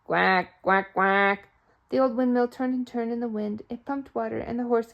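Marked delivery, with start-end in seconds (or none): 0.1-1.5 duck quack. A narrator's reading. Ducks come waddling.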